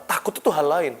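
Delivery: usual speaking voice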